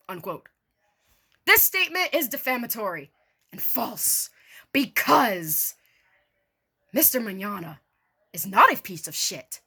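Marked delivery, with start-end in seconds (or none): none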